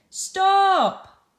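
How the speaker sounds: high, loud shout of Stop. Shown as a demonstration. Teacher acting Bob for pupils, loud shout. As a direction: yell Stop to halt him. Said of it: "exclamation mark here and how Bob said it".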